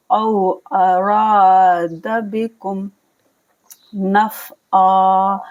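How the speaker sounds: slow, drawn-out tajweed recitation; measured Quranic recitation. Shown as a demonstration. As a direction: chant each word slowly as in Quran reading. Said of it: "आइन अलिफ ज़बर आ पढ़ के stop कर देंगे".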